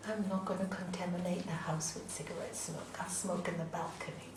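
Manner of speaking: husband quoted in a whisper; hushed husband. Retold or imitated. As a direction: breathe it out in a whisper. Husband announces he'll smoke outside.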